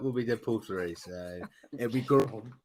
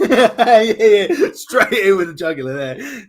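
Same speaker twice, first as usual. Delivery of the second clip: loud, high words through real laughter; helpless laughing reaction to a friend's jab; cracking up; 13 semitones higher, 17 dB louder than usual. Real, not acted. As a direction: laugh hard while getting it out.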